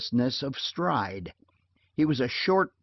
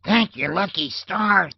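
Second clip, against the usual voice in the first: muffled call; 7 semitones higher, 5 dB louder than usual. Acted. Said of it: "Fred called in a muffled voice".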